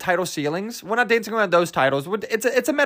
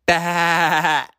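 sheep bleat. Retold or imitated. Calling listeners sheep, long mocking bleat. Imitates a sheep after "you're a sheep.

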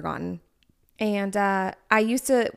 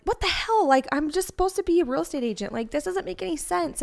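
higher, incredulous "what the hell"; exasperated disbelief; 7 semitones higher than usual. Retold or imitated. Recalling her confusion at the reading, exasperated. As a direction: throw up your hands in mock bewilderment. Retelling her frustrated reaction.

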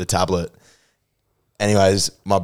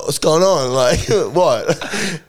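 laughing; 8 semitones higher, 4 dB louder, much slower than usual. Real, not acted. Laughing retelling his own confusion.

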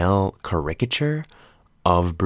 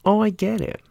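I get it jumps way higher; high mock realization. To listeners, mocking sudden understanding with a much higher voice. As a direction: repeat it pitched way up in mock revelation. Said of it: "you're like".

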